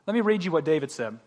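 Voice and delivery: usual speaking voice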